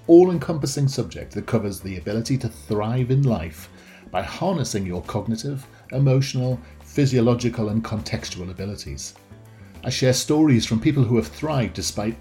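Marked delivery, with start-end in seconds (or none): none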